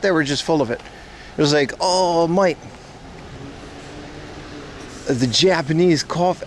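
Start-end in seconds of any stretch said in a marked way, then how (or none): 1.8-6.4 put-on failed Australian accent. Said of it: "I can't do the accent".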